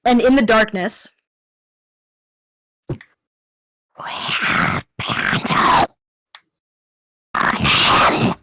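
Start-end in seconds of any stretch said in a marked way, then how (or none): 2.9-8.4 rasping unvoiced monster growl. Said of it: "practicing my monster voices".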